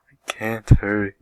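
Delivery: loud, high sobbing protest. Acted. Melody to her mother, sobbing that she can't hurry. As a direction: sob it out like a spoiled child. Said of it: "Melody sobbed".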